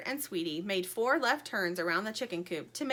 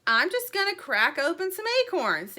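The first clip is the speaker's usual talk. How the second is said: squirrel voice; 8 semitones higher, 6 dB louder than usual. A narrator's reading. Squirrel answers the chicks.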